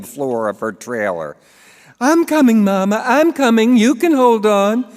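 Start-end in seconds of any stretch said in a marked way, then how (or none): 2.0-4.8 high, loud call to Mama; daughter calling back. Acted. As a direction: call back soothingly but loud across the trailer. Babs answers her mother.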